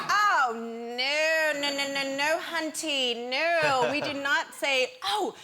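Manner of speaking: high, loud string of no's; sassy retold scolding. Correcting the air stewardess about the Overs, sassy indignation. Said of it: "And I was like".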